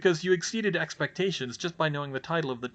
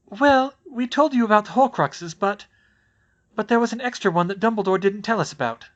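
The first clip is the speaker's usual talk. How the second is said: shakily; 8 semitones higher, 7 dB louder than usual. A narrator's reading. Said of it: "said shakily".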